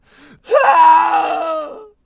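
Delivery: long, loud, high agonized scream; wail of pain. A narrator's reading. Captive gripping his gushing wound, wail of pain. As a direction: scream out in agony. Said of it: "The man wailed in pain".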